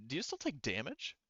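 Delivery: ordinary talk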